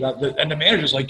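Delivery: ordinary delivery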